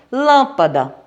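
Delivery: Lâmpada punched on LÂM; stress on first syllable. Shown as a demonstration. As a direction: hit the LÂM in Lâmpada, let the rest fall. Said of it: "a sílaba tônica é a antepenúltima".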